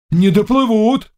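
high, excited cry; peasants shouting. A narrator's reading. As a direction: call out over the water. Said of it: "кричали мужики".

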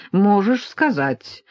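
plain reading voice

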